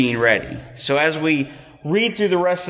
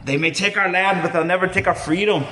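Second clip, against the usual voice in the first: hammed-up bad Scottish accent, a bit higher and faster at the end; bad Scottish William Wallace accent; 4 semitones higher, faster than usual. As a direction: do a bad Scottish rallying cry like Mel Gibson. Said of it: "That's the worst accent ever".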